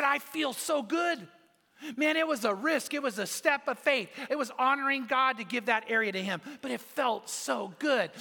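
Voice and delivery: high, emphatic retold delight, stretching "so good"; elated testimony. Preacher retelling his friend's testimony to the congregation, elated.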